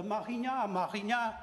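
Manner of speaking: imitating a French tourist's French accent, lightly; a French visitor's questioning French accent. A French tourist asking the way, in a French accent. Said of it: "sai o francês e pergunta".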